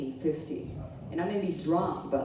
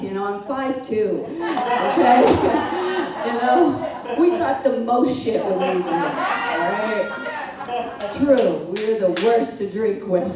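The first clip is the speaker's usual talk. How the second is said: loud, laughing rant; laughing loudly; 4 semitones higher, 9 dB louder, slower than usual. Real, not acted. Comic mocking short drinkers, loud and laughing. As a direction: crack up while making the point.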